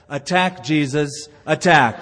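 rising to a loud barked attack; mock parrot ordering the dog to attack; parrot command. Parrot commanding the Doberman to attack, ending loud. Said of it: "the parrot said".

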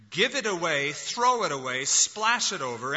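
loud, urging cries to give freely; quoting a call to spend love freely; exuberant. Retold or imitated. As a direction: fling the words out generously. Quote building with energy.

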